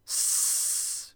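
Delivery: sharp unvoiced snake hiss; a breathy warning hiss from the snake. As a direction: hiss like an offended python. Angry python hisses.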